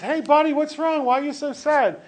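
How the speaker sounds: a chummy bartender asking what's up. Acted. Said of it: "And the bartender says".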